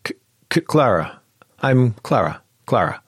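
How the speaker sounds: stammering. Acted. Clara meeting Xander, stammering. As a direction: fumble for words. Struggles to say her name.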